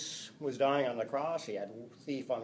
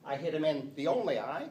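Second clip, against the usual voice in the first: "only" punched with a rise, a bit louder; stress on only; 2 semitones higher, 6 dB louder than usual. Shown as a demonstration. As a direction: make "only eye" the joke. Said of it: "the Cyclops text message".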